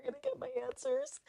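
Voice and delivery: high, frantic panic fading to a fast mumble; panicking. Retold or imitated. Calling mom about the lost login, panicked and high. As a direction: squeal in panic, then trail off fast. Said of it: "I was panicking".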